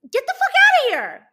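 shouted, fed-up dismissal; exasperated yell. Acted. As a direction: yell it to drive them away. Exasperated order to people in dreams.

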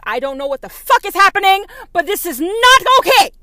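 high, very loud mock outrage; mock outraged shriek. Outraged churchgoer in the audience, high mock shriek. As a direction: shriek it like a scandalised believer.

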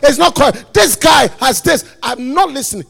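loud, fast, shouted refusal; defiant protest. Acted. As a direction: mimic an objector shouting down the message.

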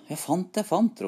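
high-pitched, eager call; lifted, eager storybook cry; excited calling out. A narrator's reading. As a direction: announce a find in a high voice. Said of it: "ropte han".

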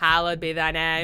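loud Boston intoning of Hallowed; Boston accent. Shown as a demonstration. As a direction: pray it like a Boston pol. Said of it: "sounds a little bit like a Kennedy".

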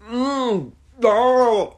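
high, loud strained grunt and moan; fat person noises. Acted. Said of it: "You make fat people noises".

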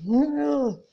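short loud yelled No; mock shout. Retelling her own table-flipping rage, a loud yelled no. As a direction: bark it out, mid table-flip.

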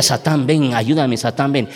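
low imitated plea to Satan; lightly voiced possessed girl. Preacher retells the girl's call to Satan, lightly possessed. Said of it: "la chamaca decía".

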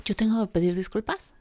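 normal talking voice

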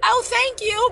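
high, sugary thank you; way up high, forced politeness; mock polite thanks. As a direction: fake a sweet reply. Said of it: "I'm looking at her like".

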